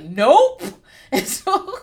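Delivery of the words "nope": loud, clipped refusal; firm nope. Retold or imitated. Answering the show's tease with a loud, emphatic refusal. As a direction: shut it down flat out. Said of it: "And I was like".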